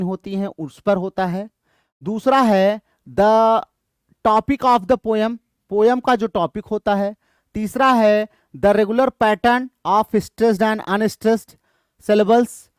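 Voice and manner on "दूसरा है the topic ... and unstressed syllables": options read slowly and louder. Shown as a demonstration. Teacher reading quiz options to students, slow and loud. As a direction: read each option out carefully.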